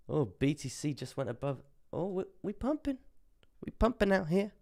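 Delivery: high-pitched excited cry of surprise. Real, not acted. Streamer reacts to BTC pumping, excited. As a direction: let the voice shoot up as the price pumps.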